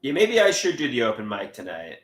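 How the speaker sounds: usual speaking voice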